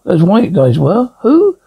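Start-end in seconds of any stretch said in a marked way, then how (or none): 1.2-1.6 sudden high startled "who"; high, sharp surprise. Acted. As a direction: jump at the word, caught off guard. Startled character hears pursuers.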